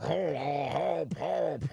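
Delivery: gasping cries for help while going under; wiener drowning. Acted. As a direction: call for help like you're sinking in water. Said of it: "my impression of a wiener drowning".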